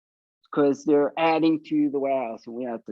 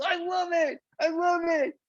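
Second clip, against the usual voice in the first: higher, excited voice for the man; lightly voiced delighted warehouse guy; 11 semitones higher than usual. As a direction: gush like you're thrilled. The man's delighted reaction.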